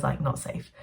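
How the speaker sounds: ordinary talk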